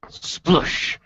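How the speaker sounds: a loud breathy mock splash; splash sound. Game master voices the lieutenant landing in snow, loud breathy splash noise. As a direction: blow out a snowy landing splash.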